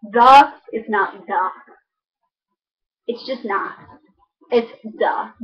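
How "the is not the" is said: "the" said as "da", slow; the TH turned to a D in "the"; Chicago accent. Shown as a demonstration. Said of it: "And also".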